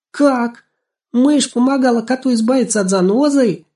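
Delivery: put-on very high, loud incredulous girl; exclaim, close to bursting with amusement; amused disbelief. A narrator's reading. Girl hearing a mouse helped a cat, amused disbelief. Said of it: "не удержалась от смеха Анастасия".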